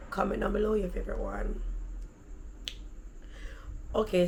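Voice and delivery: ordinary talk, nothing marked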